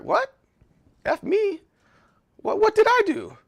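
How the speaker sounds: high, squeaky mock disbelief; mock outrage. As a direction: play the wounded innocent, way up high. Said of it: "I'm like".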